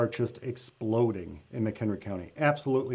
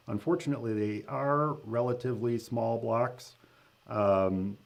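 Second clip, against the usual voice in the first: ordinary talk, nothing marked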